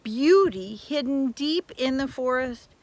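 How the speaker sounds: a lively read-aloud fairy tale voice; storybook narration. A narrator's reading. Reading a fairy tale to children, storybook narration. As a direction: tell it like a bedtime tale.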